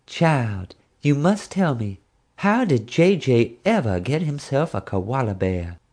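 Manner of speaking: slow, haughty Texas drawl, nose in the air; a grand Texas lady, drawn out; deep Texas accent. Retold or imitated. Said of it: "spoke in a deep Texas accent".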